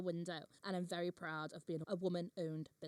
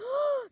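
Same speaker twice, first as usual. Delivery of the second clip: loud, high gasp of shock; 8 dB louder than usual. Real, not acted. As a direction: gasp sharply in real horror. Shocked at stroke remark.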